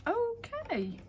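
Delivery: high, drawn-out baffled okay; mock confused reaction. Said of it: "I'm a bit confused by that".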